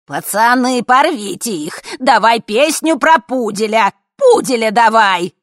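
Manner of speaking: high, loud fan shouts; hollering old ladies demanding a song; rowdy crowd yelling. A narrator's reading. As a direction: cheer them on from the hall. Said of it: "заорали старушки из зала".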